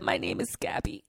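breathy whispered name. Retold or imitated. Quoting the little girl's note, hushed whisper. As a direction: whisper it like a precious secret. Reading the note's signature.

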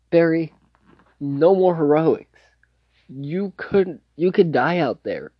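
earnest warning. Retold or imitated. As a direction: imitate pleading with someone to stop. Acted worried warning from father figure.

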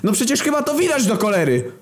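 very high-pitched exasperated yell; outraged cry; furious. A narrator's reading. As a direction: burst out at the obvious, livid. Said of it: "Kierownik był równie wkurwiony".

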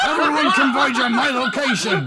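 high, loud panicked call for backup; panicked shout. Acted. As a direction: yell for help in a panic. Jerome panics, calls backup.

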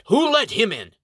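high, loud furious demand; outraged shout. Acted. Ranger captain furious at his lookouts, shouting. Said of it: "is outraged and shouts".

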